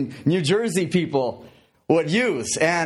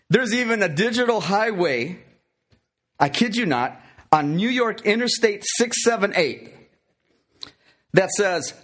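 normal talking voice

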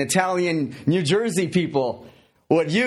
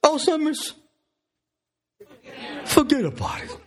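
higher mock Italian grandpa; 12 semitones higher than usual. Joke grandpa waving off his Alzheimer's, higher mock voice. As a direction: brush it off like an old Italian grandpa. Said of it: "he says".

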